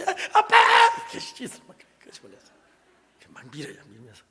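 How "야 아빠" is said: put-on child's cry. Son cries out in bathtub.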